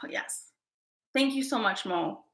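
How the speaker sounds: very soft, moved thanks; emotional. Real, not acted. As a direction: thank them softly through real emotion. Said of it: "I get very emotional".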